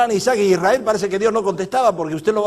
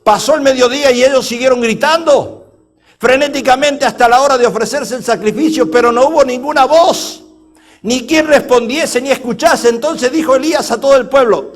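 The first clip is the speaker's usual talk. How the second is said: loud, driving preacher's reading; forceful scripture reading; 5 semitones higher, 8 dB louder than usual. A narrator's reading. Preacher reading the Elijah story to the congregation, forceful declamation. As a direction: declaim the passage at full volume.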